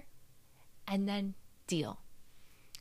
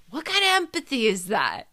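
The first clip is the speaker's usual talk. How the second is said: indignant retort; 4 semitones higher, 8 dB louder than usual. Retold or imitated. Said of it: "And I'm like".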